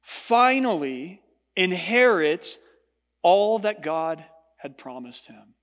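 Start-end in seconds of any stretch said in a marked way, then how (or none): 0.0-2.6 finally stretched slowly and leaned on; slow it down and weigh finally; emphasis on finally. Shown as a demonstration. Pastor stressing finally to congregation, slow and weighty. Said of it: "I emphasize that word for a reason".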